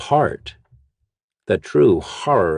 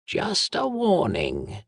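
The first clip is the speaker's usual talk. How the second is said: much higher, slow whispery woman's voice; lightly warn, half whispered; whispering woman; 12 semitones higher, 3 dB quieter, slower than usual. Acted. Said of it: "noted the whispering woman".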